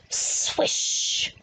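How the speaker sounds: breathy swish of wind, no voice; whooshing wind noise. Acted. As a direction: blow it like a gust of wind. Wind in the picture book.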